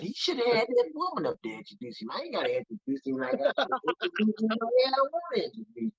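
high-pitched, indignant mock rant. Honoree ranting about how he was introduced, high indignant imitation. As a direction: pitch it way up, griping without stopping. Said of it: "he wouldn't stop".

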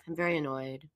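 low, soft and very flat; monotone. Shown as a demonstration. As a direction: drone it out without any emotion. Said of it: "very monotone, very flat".

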